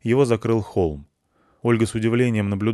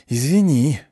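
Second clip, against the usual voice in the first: hissed through teeth; 5 semitones higher, 4 dB louder, slower than usual. Acted. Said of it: "прошипел он сквозь зубы".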